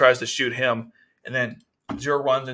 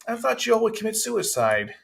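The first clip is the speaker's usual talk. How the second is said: much higher, sweet and naive; cute innocent voice; 10 semitones higher than usual. Retold or imitated. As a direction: play the innocent princess, childlike. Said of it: "She has this cute, innocent face where it's like".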